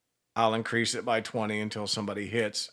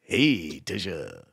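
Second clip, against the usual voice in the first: low, smooth DJ come-on; 6 semitones lower, much slower than usual. Pretend. Host teasing Tisha with a turn-on, low DJ voice. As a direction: drop low like a radio DJ flirting. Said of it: "Do you like my D J voice?".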